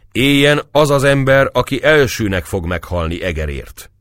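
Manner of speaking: solemn toast. Acted. Grave toast at the feast.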